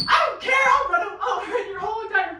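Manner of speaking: high, fast, yelled threat; hammed-up furious grandfather; retelling the grandfather threatening the team; mock threat shout. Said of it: "and he goes".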